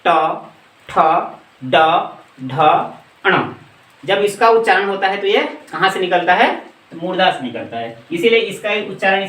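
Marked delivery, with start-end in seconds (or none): 0.1-3.5 ट, ठ, ड, ढ, ण each said apart with the tongue curled back; retroflex demo. Shown as a demonstration. Teacher sounds out ट ठ ड ढ ण for students, spaced retroflex demo. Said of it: "इसको बोल के देखते हैं".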